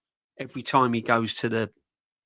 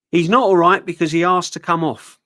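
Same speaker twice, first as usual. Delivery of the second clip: loud manager quote; 4 semitones higher, 9 dB louder than usual. Retold or imitated. Quoting the manager again.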